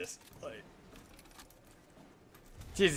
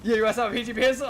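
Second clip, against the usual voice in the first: excited; 10 semitones higher, 7 dB louder, faster than usual. Real, not acted. Excited at old pistol.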